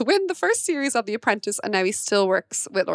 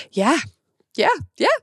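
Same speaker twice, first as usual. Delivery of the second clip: elated yeah; 6 semitones higher, 3 dB louder than usual. Retold or imitated. Reacting to getting through.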